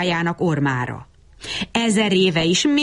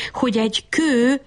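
higher-pitched, hesitant explanation; timid mumbling; 6 semitones higher than usual. A narrator's reading. Bab Berci trying to explain his errand, mumbling timidly. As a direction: bring it up meekly, pitched high. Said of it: "motyogta Bab Berci".

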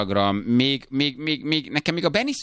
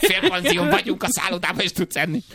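very high put-on nag; nagging holiday wife. Wife to Pista outside a gyros stand, mock scolding.

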